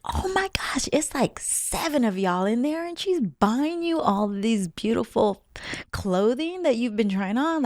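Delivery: high, excited gushing; giddy. Retold or imitated. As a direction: squeal it like a giddy friend. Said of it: "Like, I was giddy".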